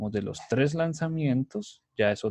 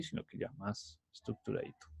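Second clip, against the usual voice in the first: soft whispered afterthought; teacher whispering a quick aside to himself; 3 semitones lower, 12 dB quieter than usual. Real, not acted.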